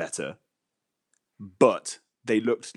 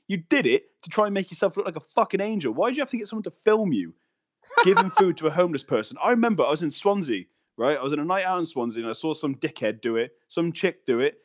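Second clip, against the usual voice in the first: ordinary delivery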